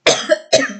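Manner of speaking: short dry-voiced coughing fit; a couple of brief coughs; real cough. Real, not acted. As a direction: cough between phrases. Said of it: "Je tousse parce que".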